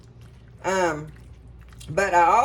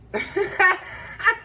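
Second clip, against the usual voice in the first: high burst of laughter; laughing fit; 11 semitones higher than usual. Real, not acted. Cook laughing at her own word mixup, high giggle. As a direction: break into giggles at herself.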